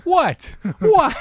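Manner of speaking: high shouted "What?" in mock outrage; mock indignant shout. Friend playing the arrested heckler, high mock shout. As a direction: bark it up high, faking outrage.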